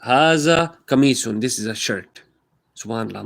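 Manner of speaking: usual speaking voice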